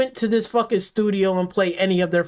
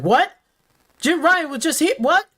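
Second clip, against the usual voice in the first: mock disbelief; 7 semitones higher than usual. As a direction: ham up a stunned worker. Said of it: "They like".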